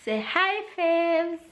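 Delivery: high singsong coaxing. Real, not acted. Coaxing toddler to greet viewers.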